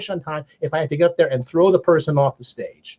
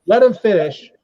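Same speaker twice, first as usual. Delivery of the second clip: called out from the back, higher; yelling out; 5 semitones higher, 4 dB louder than usual. Retold or imitated. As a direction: shout over the room. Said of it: "I yell out".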